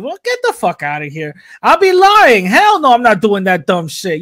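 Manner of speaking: normal talking voice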